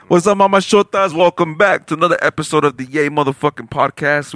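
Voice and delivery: loud, upbeat show opener; podcast host intro. Acted. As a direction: hype up the listeners like a host.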